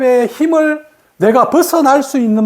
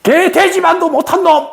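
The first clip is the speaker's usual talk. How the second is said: higher-pitched mock scolding of a child; parent scolding; 7 semitones higher than usual. Teacher imitates a parent insulting a child, a mock scolding. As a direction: play the parent's harsh insult, raised up high. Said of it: "어릴 때 부모님이 이야기합니다".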